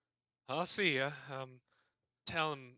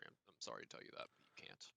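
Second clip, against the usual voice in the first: whispered; 8 semitones lower, 19 dB quieter, faster than usual. Acted. GM mock apology.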